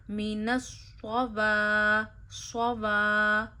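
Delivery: "स्ववा" with a heavy, doubled स्वाद and a pause before it; heavy stressed saad. Shown as a demonstration. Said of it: "तशदीद की वजह से स्वाद पे ज़ोर डालना है". ("स्ववा" में स्वाद पर ज़ोर और हल्का ठहराव)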